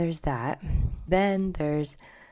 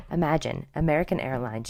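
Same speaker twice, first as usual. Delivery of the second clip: ordinary delivery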